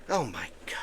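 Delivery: hushed, low groan of disbelief; exasperated whisper. Real, not acted. Exasperated aside after mocking voters.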